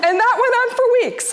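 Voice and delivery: laughing high and fast through the words. Real, not acted. Speaker recalls mom's cold replies, laughing. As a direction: giggle it out, pitch up high.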